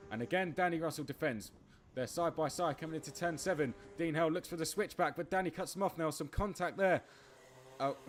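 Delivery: fast, high, loud play-by-play; excited race commentary. Acted. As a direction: call the race as it happens. Live race commentary building up.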